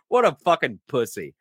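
ordinary talk

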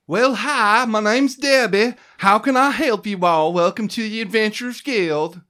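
high, loud, chirpy receptionist voice; clerk voice. Acted. GM voicing Debbie at the guild desk, chirpy and high. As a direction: greet them like a cheery desk clerk. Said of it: "the woman there says".

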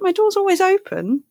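a hint of breezy Ridcully; Ridcully impression. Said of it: "Riccully's like".

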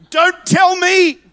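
preacher shouting to his congregation; preaching shout. Real, not acted. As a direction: hammer it home to the crowd. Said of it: "power in your shout".

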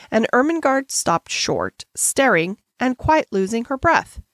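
ordinary delivery, nothing marked

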